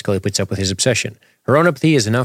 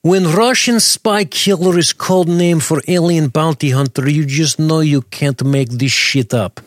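slow, higher mock Russian accent; 7 semitones higher, 4 dB louder than usual. Host reading a listener comment in mock Russian accent. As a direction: read it like a hammed-up Russian spy. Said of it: "this is exactly how she talks".

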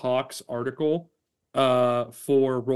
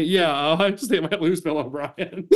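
slow, giggling return to the topic; laughing; 6 semitones higher, 4 dB louder, slower than usual. Real, not acted. Host abruptly returning to football news, laughing through it. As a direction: try to get back on track but crack up.

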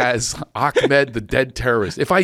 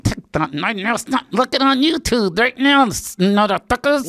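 ventriloquist demo; 9 semitones higher than usual. Shown as a demonstration. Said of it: "You're pretty good ventriloquist".

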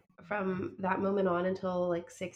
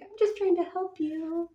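put-on higher, sweet little kid voice; cute kid voice; 10 semitones higher, 5 dB louder than usual. Co-host voicing a helpful toddler, high and sweet.

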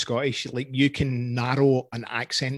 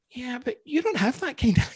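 high retold objection; imitated family reply; 9 semitones higher than usual. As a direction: pitch it up, quoting the kids' objection. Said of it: "but they're like".

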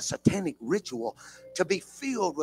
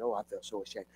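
ashamed; 7 semitones lower, 11 dB quieter, faster than usual. Retold or imitated. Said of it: "I said".

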